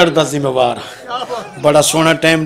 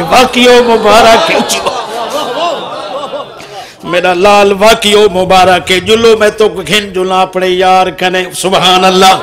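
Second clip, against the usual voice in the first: loud, raised preacher's voice; full-force preaching; 4 semitones higher, 6 dB louder than usual. Acted. Preacher hailing the congregation with good news, full-force preaching. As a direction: proclaim it to the whole gathering.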